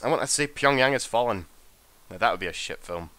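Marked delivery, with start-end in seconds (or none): none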